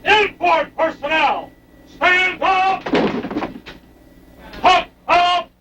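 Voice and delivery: high, drawn-out shouted commands; jumpmaster barking cues. Real, not acted. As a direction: bark each order across the plane. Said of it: "voice commands that control and direct the paratroopers".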